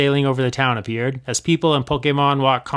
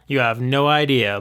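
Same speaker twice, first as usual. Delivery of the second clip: ordinary delivery, nothing marked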